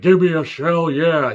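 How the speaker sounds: lightly imitated wrestler catchphrase; Stone Cold Steve Austin. Reviewer quoting Shark Boy's catchphrase, light wrestler impression. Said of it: "really good impression of Stone Cold Steve Austin".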